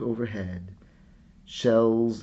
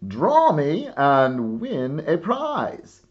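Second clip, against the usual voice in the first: magazine ad voice; 5 semitones higher, 9 dB louder, slower than usual. Acted. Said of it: "it said underneath".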